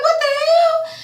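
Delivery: high squeal of disbelief; high-pitched what the hell; shocked. Retold or imitated. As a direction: shriek it in outrage, way up high. Shocked reaction to insult.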